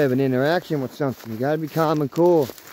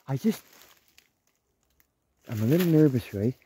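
ordinary delivery

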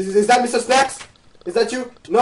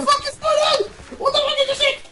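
very high panicked squeal; high-pitched shriek. Real, not acted. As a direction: shriek it far above your usual pitch. Panicking as players attack.

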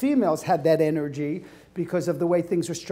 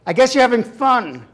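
louder sneering cheer; sarcastic; 5 semitones higher, 6 dB louder than usual. Retold or imitated. Boyfriend's resentful text, louder sarcasm. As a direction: bite through the smile.

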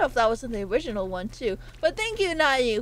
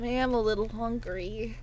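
ordinary delivery

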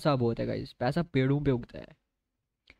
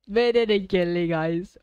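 higher and louder, pressing the point; louder insistent repeat; 7 semitones higher, 4 dB louder than usual. Real, not acted. Streamer to viewers, repeating his protest, higher and louder. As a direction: insist again, voice rising.

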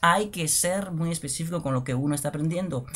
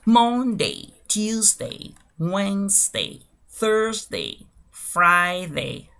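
measured word-by-word model, higher pitched; slow demonstration; 4 semitones higher, 5 dB louder, much slower than usual. Shown as a demonstration. Teacher giving only the pronunciation to learners, slow and deliberate. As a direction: spell out each word's sound slowly, pausing between. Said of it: "lo voy a hacer de manera lenta y despacio".

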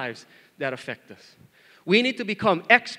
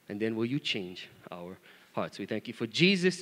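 soft, lowered praying voice; prayer; 4 semitones lower, 4 dB quieter than usual. Real, not acted. Pastor leading the church in prayer, soft and low. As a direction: pray quietly, in unhurried phrases. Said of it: "All right, let's pray".

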